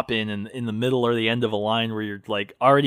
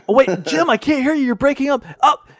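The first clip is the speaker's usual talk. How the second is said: high, loud broken-up phone voice; bad connection; 11 semitones higher, 4 dB louder than usual. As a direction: fake the line cutting out, high. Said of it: "pretend like phone's breaking up".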